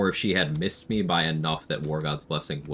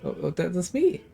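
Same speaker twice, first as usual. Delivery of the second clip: excited; 12 semitones higher than usual. Real, not acted. Spots himself in game.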